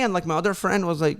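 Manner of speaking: normal talking voice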